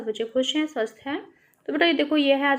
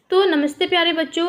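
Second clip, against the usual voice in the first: cheerful greeting; 7 semitones higher, 7 dB louder, faster than usual. Real, not acted. Teacher greets class brightly.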